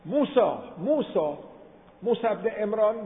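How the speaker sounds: high-pitched imitated cry for help; mock desperate calling; retold repeated calling. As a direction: call the name twice, pleading from afar. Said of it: "فرعون هی داد زد".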